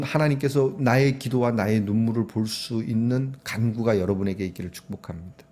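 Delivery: ordinary talk, nothing marked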